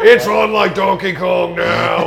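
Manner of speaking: loud mock fighting words with a laugh; shouted challenge. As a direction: shout it as a playful declaration of war. Playful challenge to rival.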